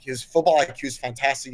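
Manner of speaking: normal talking voice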